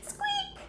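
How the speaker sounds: tiny high mouse squeak; a quick squeaky mouse noise. Acted. Squeaking like a tiny mouse hidden in the house.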